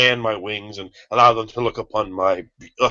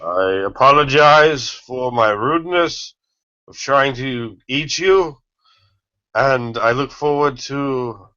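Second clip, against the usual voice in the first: slow formal apology; 4 semitones higher, slower than usual. Acted.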